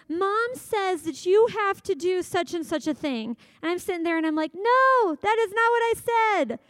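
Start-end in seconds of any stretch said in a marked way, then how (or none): 0.1-3.4 higher, slower whiny kid voice, a touch mock; tattling kid. Kids tattling from another room. 4.5-6.6 much higher and louder, NOT hit hard; exasperated mom. Retold or imitated. Mom correcting the lying kids.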